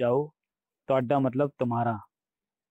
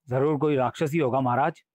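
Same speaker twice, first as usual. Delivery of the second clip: ordinary delivery, nothing marked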